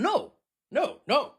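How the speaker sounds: high, loud "no"; emphatic refusal. Acted. Host rejecting a scam pitch, emphatic refusal. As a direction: shoot it down loudly, high-pitched.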